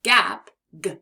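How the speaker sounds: gap punched loud; gap stressed. Shown as a demonstration. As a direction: hit gap, then the g. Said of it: "the word gap".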